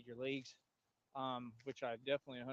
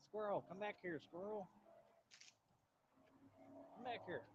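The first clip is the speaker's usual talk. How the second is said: calling to a squirrel; 5 semitones higher, 5 dB quieter than usual. Real, not acted.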